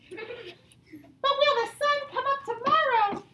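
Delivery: slow, high-pitched squirrel voice; questioning little squirrel. Acted. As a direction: ask way up high like a tiny storybook squirrel. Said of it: "Brown Squirrel asked".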